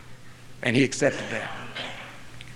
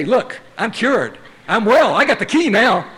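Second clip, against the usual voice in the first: higher, slow at first, proud proclamation; grandiose boast; 8 semitones higher, 6 dB louder, slower than usual. Retold or imitated. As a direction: announce it like the man with the key. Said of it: "The powerful one with the key".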